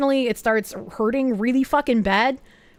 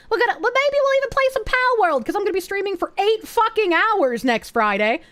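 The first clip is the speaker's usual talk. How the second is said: excited; 7 semitones higher than usual. Real, not acted. Excited about eight-hour stream.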